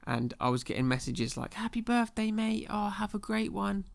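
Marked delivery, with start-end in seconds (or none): 1.6-3.9 high-pitched mock birthday greeting; cheery well-wisher. Friends messaging him on the wrong birthday, cheery mock greeting. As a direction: imitate a well-wishing mate, pitched up.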